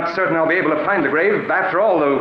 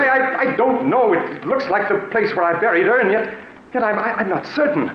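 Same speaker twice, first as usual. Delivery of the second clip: flustered panic; 7 semitones higher than usual. Acted. Roger can't find the grave.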